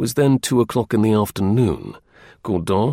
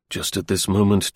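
ordinary delivery, nothing marked

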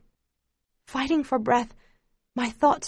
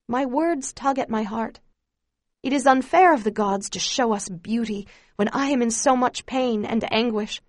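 ordinary delivery, nothing marked

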